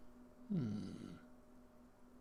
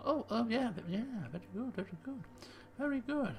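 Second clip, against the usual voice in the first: quick, much softer, dismissive very good; humouring; 6 semitones higher, 7 dB quieter than usual. Acted. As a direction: placate him with a quiet aside. Detective humours the mystic.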